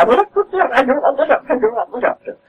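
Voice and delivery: mock her insistent no to the doctor; stubborn old friend.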